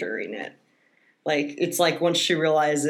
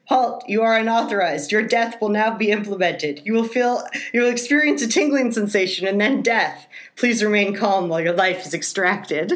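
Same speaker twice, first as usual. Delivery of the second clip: calm robot voice, higher and a bit louder; gentle care robot; 5 semitones higher, 3 dB louder than usual. Retold or imitated. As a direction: calmly announce death like a polite machine. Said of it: "what did they say".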